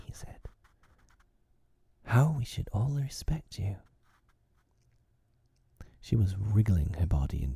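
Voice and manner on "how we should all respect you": put-on higher, wheedling boy's flattery; cunning. A narrator's reading. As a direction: flatter her to win her over. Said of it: "He had become frightfully cunning".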